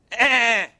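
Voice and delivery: put-on high, loud start of a kid's whine; a kid's whine cut short, high and loud; mock whimper. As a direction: imitate a toddler about to cry. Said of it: "they go".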